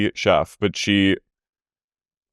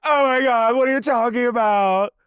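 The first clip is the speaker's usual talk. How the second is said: much higher outraged imitation; mock Lisa; 13 semitones higher than usual. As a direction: shriek it like an offended Lisa. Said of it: "and Lisa goes".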